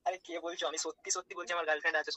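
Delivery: normal talking voice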